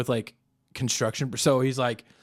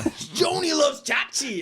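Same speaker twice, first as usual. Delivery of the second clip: higher, slow shouted; mock crowd yell; 13 semitones higher, slower than usual. Yelling from a crowd.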